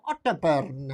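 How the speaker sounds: oddly accented "Otterburn"; "Otterburn" in a strange, muddled accent; weird accent. Real, not acted. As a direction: slip into an unplaceable accent on "Otterburn". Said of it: "I don't know what accent Otterburn was said in then".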